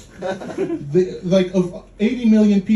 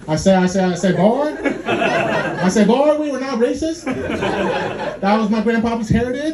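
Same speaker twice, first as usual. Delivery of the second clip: higher, laughing Southern cartoon rooster; 6 semitones higher than usual. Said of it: "a cartoon, uh, cartoon rooster".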